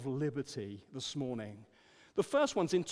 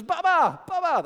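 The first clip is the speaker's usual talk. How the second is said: put-on high, loud baby call; toddler voice; 12 semitones higher, 13 dB louder than usual. Grandson calling grandpa on the video call, toddler call. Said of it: "and he goes".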